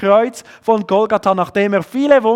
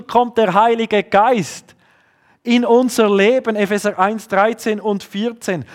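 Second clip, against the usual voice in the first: ordinary delivery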